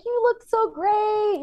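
high, drawn-out retold compliment; gushing praise. Visitor cheering children at the hospital, gushing praise. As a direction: coo it slowly, way up high.

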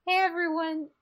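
high, loud announcer voice; chirpy public service plea; mock PSA.

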